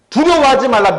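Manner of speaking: high, loud exhortation; emphatic command. Retold or imitated. Jesus' command proclaimed to the congregation, emphatic and loud. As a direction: proclaim it as a rousing charge.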